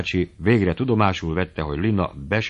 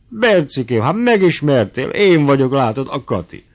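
much higher and louder, cooing delight; gushing old aunt; 6 semitones higher, 7 dB louder than usual. Acted. Old aunt greeting her ex-fiancé, gushing. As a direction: throw your arms open with your voice.

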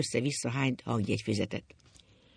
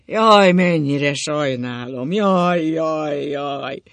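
loud, long-drawn wail, slowly sinking away; howling grief; 10 dB louder, much slower than usual. A narrator's reading. Father tormented by the dream of his daughter, howling lament. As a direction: howl the woe out, then let it fade. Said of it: "Szinte csaholt a keservét".

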